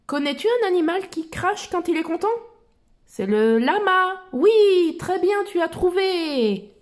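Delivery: put-on bright, high child explorer voice; Dora voice. Reading Dora's lines to little listeners, in a high cheerful voice. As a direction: lift the voice like Dora talking to kids.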